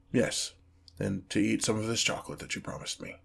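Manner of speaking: soft, halting confession from a demon; sheepish admission. Acted. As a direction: admit a small wish, hesitantly and quietly. Demon shyly admits human wishes.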